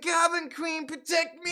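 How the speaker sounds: high mock shout for rescue; scared cry for help. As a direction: yell for the queen like a frightened kid. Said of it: "He's like".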